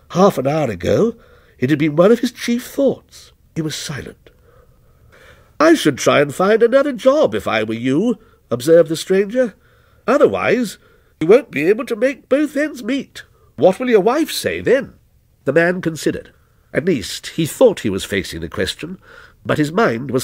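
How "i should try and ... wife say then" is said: lightly voiced stranger, detached and ominous; stranger's voice. Lightly voiced stranger answering the night watchman, a touch aloof.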